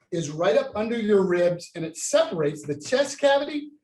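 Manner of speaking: usual speaking voice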